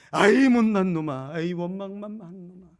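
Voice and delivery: slow, loud scornful jeering; mock insults. Imagined God insulting the congregation, slow loud scorn. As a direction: drag out each insult with contempt. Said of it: "하나님이 이렇게 부르지 않습니다".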